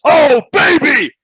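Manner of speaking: full-throated, stretched commentator shout; pumped-up commentary. Acted. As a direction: roar the big dunk call, high and loud. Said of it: "whenever I get pumped up".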